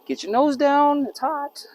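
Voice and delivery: high, loud snap at the dog; sharp warning. Real, not acted. Owner warns the dog off the hot dish, high loud snap. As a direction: jump in to stop the dog's nose.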